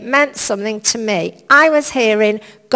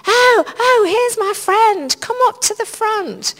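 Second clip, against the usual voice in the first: high, delighted hostess call, much higher in pitch; gushing call-out; 12 semitones higher, 4 dB louder than usual. Retold or imitated. A friend at the microphone calling her up to the front, high and delighted. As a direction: beckon the friend up with a bright high voice.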